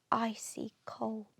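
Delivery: breathy narrator whisper on the cold sea; hushed whisper. A narrator's reading. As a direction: drop to a whisper for the icy water. Narrator chills the stormy sea.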